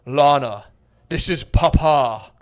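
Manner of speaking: loud, slow, booming father impression; sky-voice Papa. Imitating the dad's audio letter to his daughter, loud grandiose father. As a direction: announce yourself like a grand father from above. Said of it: "so her dad started".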